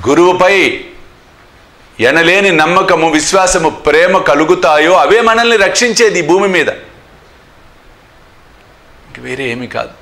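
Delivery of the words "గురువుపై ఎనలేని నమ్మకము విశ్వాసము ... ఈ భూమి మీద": louder, higher impassioned sermon; fervent preaching. Acted. Sai devotees hear the guru's promise, fervent preaching. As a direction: preach it with rising fervour.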